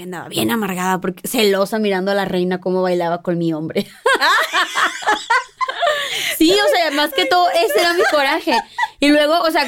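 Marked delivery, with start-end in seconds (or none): 4.0-6.3 high burst of laughter. Real, not acted. Laughing at her own story. 6.4-9.7 high voice through laughter; laughing while talking. Real, not acted.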